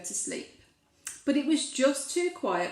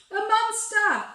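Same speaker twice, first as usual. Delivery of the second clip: high, loud gasp of a reveal; spring the monster on the listener; dramatic reveal; 11 semitones higher, 6 dB louder than usual. A narrator's reading. Reading to a child, a high loud dramatic reveal.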